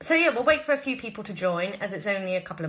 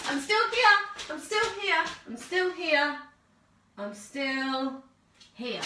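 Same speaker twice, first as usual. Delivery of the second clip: higher, called out from across the room; calling from off mic; 7 semitones higher, slower than usual. Real, not acted. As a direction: call back to the camera from the light switch. Turning kitchen lights down, calling back.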